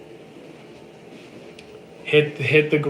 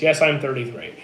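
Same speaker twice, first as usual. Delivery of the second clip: ordinary talk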